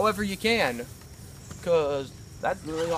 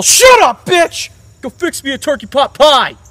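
higher, much louder bellowing; furious dad shout; 8 semitones higher, 14 dB louder than usual. Retold or imitated. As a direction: scream it like a drunk bully of a father. Abusive dad yelling at mother.

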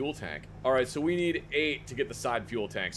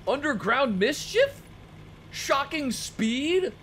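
incredulous; 11 semitones higher than usual. Real, not acted. Reading odd perks in disbelief.

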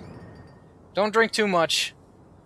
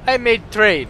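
broken English in a mock Russian accent, louder; 4 semitones higher, 7 dB louder than usual. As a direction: clip the words like a Russian tourist. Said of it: "he's Russian".

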